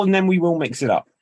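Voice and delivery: normal talking voice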